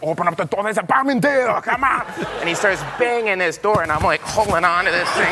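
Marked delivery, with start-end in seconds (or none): 0.0-2.0 put-on high, strained Austrian accent yell; Arnold's Austrian accent, pumped up; Schwarzenegger accent. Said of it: "And he's like".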